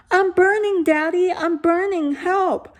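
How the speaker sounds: high, loud hammed-up child shrieking; mock child screaming. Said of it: "started screaming again, even louder".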